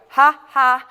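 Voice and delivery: loud, staged ha-ha on the beat; mock laugh. Shown as a demonstration. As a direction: belt out a fake laugh. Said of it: "and you're gonna go".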